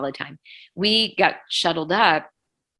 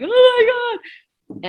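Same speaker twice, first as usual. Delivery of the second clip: very high, loud cry of disbelief; shocked outcry; 10 dB louder, slower than usual. Retold or imitated. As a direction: let it burst out in horror. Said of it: "And I'm like".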